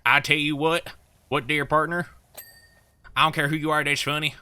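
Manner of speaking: mock southern accent telling a joke. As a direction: play a folksy southern cowboy. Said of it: "like, the really, like, southern".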